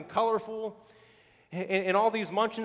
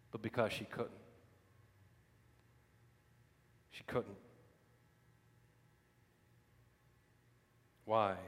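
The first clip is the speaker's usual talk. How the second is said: preacher lowering voice for weight; hushed preaching; 9 semitones lower, 12 dB quieter than usual. Acted. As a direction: drop to an intimate hush. Quiet intense preaching passage.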